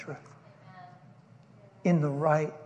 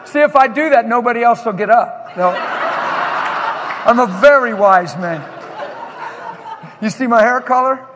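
loud, fast building sermon; fiery preaching; 2 semitones higher, 7 dB louder than usual. Acted. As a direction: preach it with rising fire. Preaching at full force.

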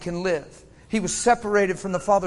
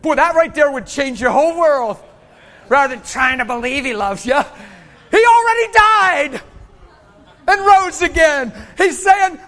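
loud, high, emphatic preacher; preacher to congregation about God's love, full-force preaching; 7 semitones higher, 10 dB louder than usual. Real, not acted. Build into a loud, high proclamation.

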